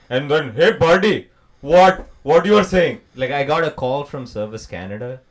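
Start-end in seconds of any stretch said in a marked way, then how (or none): 0.5-3.0 loud, brash Indian accent; broad, pushy Indian-accented question; second Indian accent. Shown as a demonstration. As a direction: challenge a pal in a punchy Indian accent. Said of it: "there are two Indian accents".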